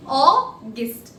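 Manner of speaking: AUGUST split as Au, pause, gust; syllable-by-syllable demo. Shown as a demonstration. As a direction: break "August" into two separate syllables. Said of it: "this is how we pronounce August".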